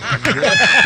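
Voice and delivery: high laughing burst mid story; burst of laughter. Real, not acted. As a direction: cracks up loudly. Laughing while retelling scene. (carcajada alta)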